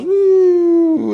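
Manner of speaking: high whooshing wave sound, far above the speaker's own voice. Retold or imitated. Preacher voicing the ocean wave as it stops, whooshing. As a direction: let the wave swoosh in, way up high.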